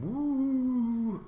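long, high mystical woo; airy woo. Retold or imitated. Speaker recalling trying universe alignment, mock mystical high woo. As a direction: slide up into a drawn-out woo. Mocking cosmic alignment with a noise.

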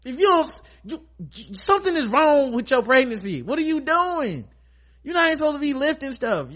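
mild mock scolding of a reckless pregnant woman; exasperated scolding. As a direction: fuss at her like a worried friend. Scolding Maggie on the show.

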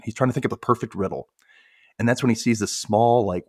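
ordinary talk, nothing marked

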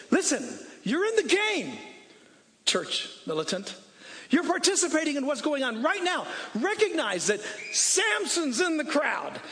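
rally the church like a coach at a game; full-force preaching. Acted. Preacher quotes Hebrews at full force.

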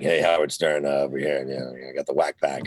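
low, laid-back radio host mumble; Howard Stern. Retold or imitated. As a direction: imitate the settled shock jock, down low and soft. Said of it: "And now he's like".

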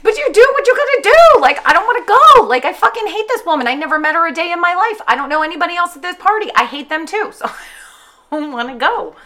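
high, loud, ranting outburst; venting loudly about a dreaded party. Real, not acted. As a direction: yell it out, fed up. Escalating rant about party.

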